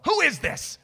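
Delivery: high, sharp challenge down the phone; suspicious mom. Retold or imitated. Mom jumping on the phone to question a caller, suspicious. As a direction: imitate a mother demanding an answer.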